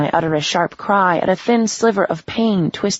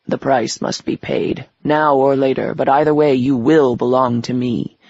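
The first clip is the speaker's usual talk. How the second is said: a lightly lowered, menacing devil; 4 dB louder than usual. Acted. Devil character voice.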